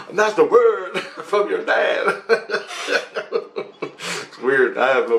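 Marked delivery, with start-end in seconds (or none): 0.0-3.7 slow, high, laughing pronouncement; real laughing. Real, not acted. Dad gives his daughter the last word, laughing. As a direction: draw out the verdict while cracking up. Mock fatherly verdict, cracking up.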